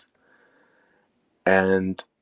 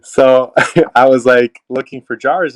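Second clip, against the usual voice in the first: laughing loudly through the words; 5 semitones higher, 10 dB louder than usual. Real, not acted. Recalling spending everything and having no jars, laughing loudly. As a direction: crack up at the memory of no jars. Laughing at own money mistake.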